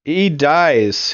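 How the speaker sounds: ordinary talk, nothing marked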